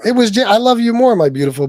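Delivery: loud, high plea to his wife; loud pleading. Real, not acted. Husband begs his angry wife on air, loud and high. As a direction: beg her loudly to hear him out.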